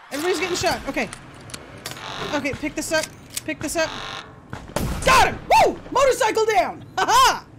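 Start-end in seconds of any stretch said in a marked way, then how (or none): 5.0-7.5 high, loud excited whoops; triumphant cheer. Real, not acted. Player cheering as guards shoot the biker. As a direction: cheer the shot like a win.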